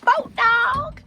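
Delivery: high, loud happy squeal; delighted greeting; excited. Real, not acted. Delight at dog.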